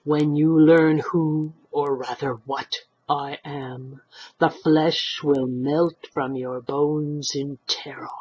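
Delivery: slow, harsh, whispered monotone; slow, monotonous harsh golem threat. Acted. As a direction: menace them in one dead tone. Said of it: "His voice was harsh and monotonous".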